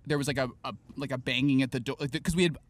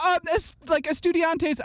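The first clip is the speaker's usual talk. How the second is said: high, sing-song teacher voice; imitating the teacher; 12 semitones higher than usual. Teacher dismissing her class, high mock voice. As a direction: mimic the distracted Spanish teacher. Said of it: "would be like".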